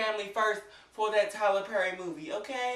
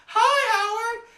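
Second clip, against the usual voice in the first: imitating her hi; 9 dB louder, much slower than usual. Imitating her higher greeting.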